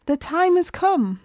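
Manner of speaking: high-pitched announcement as the walrus; walrus proclaiming. A narrator's reading.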